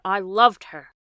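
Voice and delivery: loud grieving cry; anguished cry. A narrator's reading. Father repeats louder.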